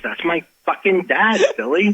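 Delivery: imitating someone floored by recognition; retold astonishment. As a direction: act out a startled realization. Retelling girlfriend's shocked recognition of father.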